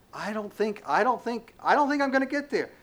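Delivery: high-pitched mock doubting; lightly acted fretful protest; acted self-doubt. Voices a doubting believer.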